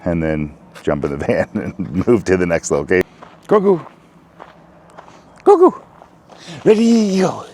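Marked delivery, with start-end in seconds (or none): 3.5-3.9 high call across the yard; calling the dog. Real, not acted. 5.4-5.8 much higher, louder shout for the dog. Real, not acted. Calling the dog outside. 6.6-7.4 excited start call. Real, not acted. Starting the dogs' run.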